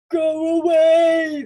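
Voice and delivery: high, slow, loud moan of a talking house. A narrator's reading. The house warns Olga off with a moan. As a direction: draw out a spooky wail. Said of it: "the house moaned".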